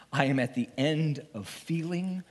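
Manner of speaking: normal talking voice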